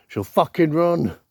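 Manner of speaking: high, loud comic outburst; sudden mock-alarmed shout; emphatic yell. Jokes about lass fleeing at sightings.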